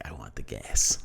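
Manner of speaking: very low, soft put-on voice; hushed, deep comic insistence; mock craving. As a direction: imitate a deep, greedy demand. Retelling his greedy request for gas.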